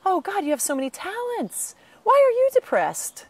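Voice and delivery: high, loud, incredulous mock outburst; exasperated friend. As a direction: play the baffled friend who just doesn't get it. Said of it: "people throughout my life say".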